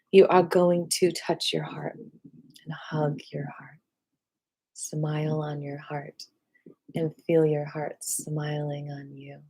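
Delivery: slow, soft meditation voice; guided meditation. Real, not acted. Teacher guiding students to hug their hearts, soft meditation.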